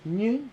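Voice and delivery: high, soft imitated creak; tire creak noise. Telling friend how tire bent, squeaky creak noise. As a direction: make the bending axle's sound. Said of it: "my back tire goes".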